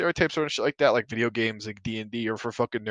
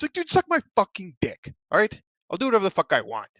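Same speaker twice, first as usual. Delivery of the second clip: heated retort; 8 semitones higher, 4 dB louder than usual. Real, not acted. Angry retort to critics.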